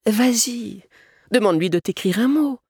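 high-pitched coaxing mother; high, urging mum voice; insistent mother. A narrator's reading. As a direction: nudge a shy child forward in a high voice. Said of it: "insista sa mère".

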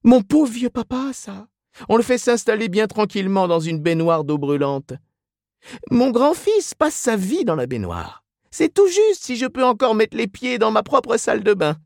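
very high put-on women's voices; complaining gossips. A narrator's reading. Two women trade aches at bakery counter, complaining. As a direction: moan about ailments in a high falsetto.

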